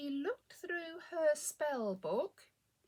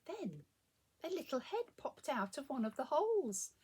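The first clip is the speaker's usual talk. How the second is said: ordinary delivery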